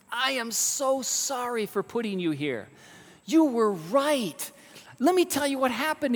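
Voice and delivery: high-pitched, emphatic contrition; urgent apology. Acted. King to jailed friend, urgently sorry.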